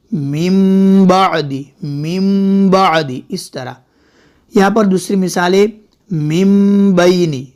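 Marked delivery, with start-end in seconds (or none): 0.1-3.2 slow मिन बादी said as mim baadi; m sound. Shown as a demonstration. Quran teacher repeats the correct m sound. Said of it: "मीम की आवाज़ आएगी". 6.1-7.5 slow मिन बैनी said as mim baini; m sound. Shown as a demonstration. Quran teacher demonstrates the m sound to students. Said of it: "मिन नहीं पढ़ेंगे बल्कि".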